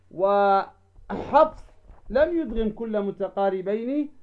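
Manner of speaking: usual speaking voice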